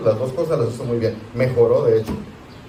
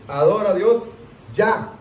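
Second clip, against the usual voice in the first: mock shouted order; 6 semitones higher than usual. Shown as a demonstration. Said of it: "Ay, gritó el hermano Antonio".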